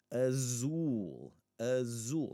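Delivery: azul with a long 'oo' in the last syllable; pronunciation demo. Shown as a demonstration. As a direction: model 'azul', stretching the stressed 'zul'. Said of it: "syllable that we wanna pronounce as U".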